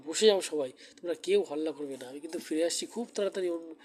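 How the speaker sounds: normal talking voice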